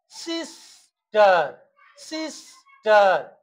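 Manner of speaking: সিস্টার slowly split, সিস, টার; broken into syllables. Shown as a demonstration. Teacher showing students syllables, সিস্টার in two slow parts. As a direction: draw out সিস, pause, then টার. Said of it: "দুবার উচ্চারণ করছি".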